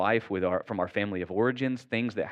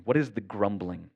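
normal talking voice

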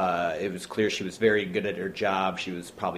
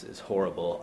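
ordinary talk, nothing marked

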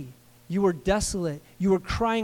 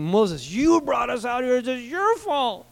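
blaming complaint; 5 semitones higher, slower than usual. Acted. Israelites blaming Moses.

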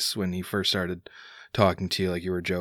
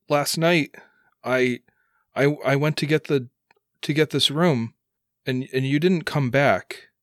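higher, halting accusation; upset son; 5 semitones higher, 4 dB louder than usual. Acted. Son recalls dad never came back, upset. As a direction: piece it together, hurt, pitched up. Son confronts father about leaving.

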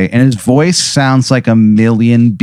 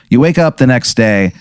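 normal talking voice

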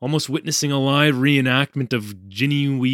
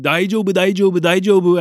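higher, louder rushed repetition; yelling; 6 semitones higher, 6 dB louder, faster than usual. A narrator's reading. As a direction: yell it over and over, urgently.

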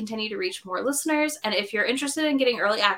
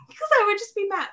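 laughing; 13 semitones higher than usual. Real, not acted. Laughing about hypothetical anger.

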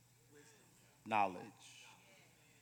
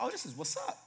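higher, delighted realization; pleased younger self; 9 semitones higher than usual. Retold or imitated. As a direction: play your naive younger self, thrilled. Imitating his younger self pleased.

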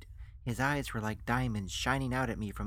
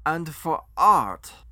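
very loud, slow, high declaration; accented foreign financier, grandiose; 4 semitones higher, 13 dB louder, much slower than usual. Acted. Financier adds his artistic motive, loud accented flourish.

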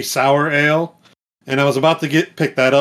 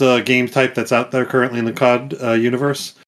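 ordinary talk

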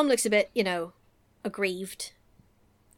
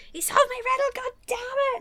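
high, hammed-up tantrum yell. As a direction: throw a Rumpelstiltskin-style fit. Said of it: "full-on Rumpelstiltskin level tantrum".

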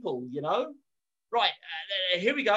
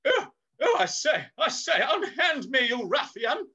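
high, hammed-up Victorian gentleman; hammy stage actor; 4 semitones higher than usual. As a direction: overplay the outraged toff. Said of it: "you're really good at this acting too".

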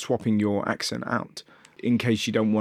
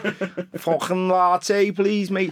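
a laughing, higher, slow Scouse coffee order; mock Scouse; 7 semitones higher, slower than usual. Mocking a Scouse customer ordering coffee in a laughing, slow Scouse accent. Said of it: "and you're like".

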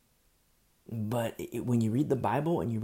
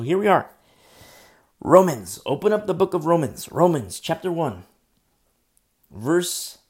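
much louder, calling it out; loud announcement; 5 semitones higher, 11 dB louder than usual. Real, not acted. Preacher announcing the reading of Romans, loudly. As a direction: call the text out to the room.

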